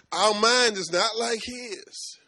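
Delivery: slow and loud, every word weighed; preacher stressing the lesson, slow and loud; slow emphatic preaching. Real, not acted. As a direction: drag it out to drive it home.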